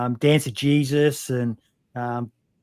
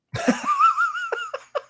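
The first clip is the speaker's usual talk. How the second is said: a sudden high burst of laughter. Real, not acted. Laughs at own marble-mouth joke.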